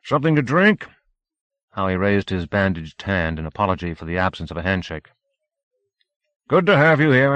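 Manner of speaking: jovial party host welcoming a guest; rotund voice. Acted. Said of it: "a rotund voice inquired".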